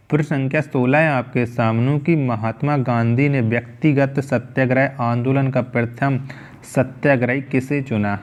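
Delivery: usual speaking voice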